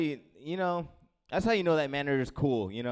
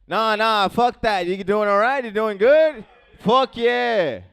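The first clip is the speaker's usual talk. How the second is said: loud, high crowd hype yelling; hype shout; 8 semitones higher, 12 dB louder, slower than usual. Real, not acted. Comic hyping the crowd from stage, loud shout. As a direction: fire up the crowd with a yell.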